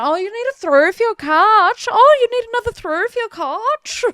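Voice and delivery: a hammed-up posh Melbourne shopkeeper; Prue and Trude voice. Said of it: "we used to just talk like them at home".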